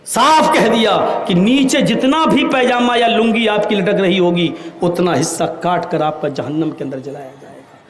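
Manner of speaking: normal talking voice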